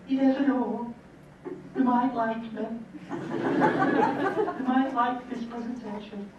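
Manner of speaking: thin high timid inner voice, slowed; a nervous inner voice hoping they like you; weedy voice. Retold or imitated. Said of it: "in a weedy voice in the head".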